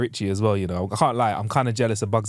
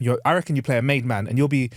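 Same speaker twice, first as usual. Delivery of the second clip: normal talking voice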